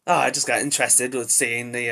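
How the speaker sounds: ordinary talk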